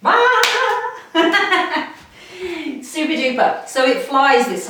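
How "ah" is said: loud, high-pitched "ah!"; startled yelp. Real, not acted. Teacher losing the ball mid-exercise, loud high yelp. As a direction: yelp as the ball gets away.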